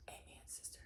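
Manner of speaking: barely audible whispered agreement; whispered aside. Real, not acted. Narrator whispers agreement about grown-ups to listeners. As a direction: murmur it under your breath, amused.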